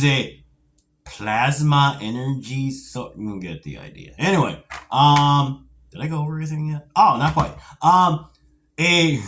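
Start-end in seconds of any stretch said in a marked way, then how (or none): none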